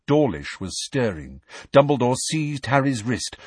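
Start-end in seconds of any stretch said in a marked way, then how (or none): none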